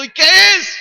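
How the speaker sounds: high, very loud shouted question; hammed-up yell, stretched on the last word; imitated eager demand. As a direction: mimic a crowd clamoring to know.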